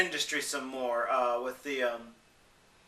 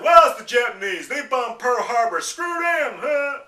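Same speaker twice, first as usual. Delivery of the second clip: loud, high shouting rant; mock ignorant bigot; 6 semitones higher, 7 dB louder than usual. Imitating a person dismissing Japan's disaster, as a loud, high mock rant. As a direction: bark it like a loudmouth. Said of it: "I had one person say".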